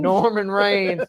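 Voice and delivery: a hint of the grandma voice, slow; echoing grandma. Cohost repeats grandma's mispronunciation, slowly. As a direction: savor the nickname. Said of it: "That's what I'm calling him".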